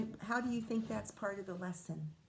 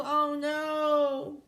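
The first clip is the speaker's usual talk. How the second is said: sudden higher, louder worried cry; the dragon spots trouble; dragon's alarmed oh no; 6 semitones higher, 5 dB louder than usual. Acted. Dragon spotting the beast, a loud alarmed cry.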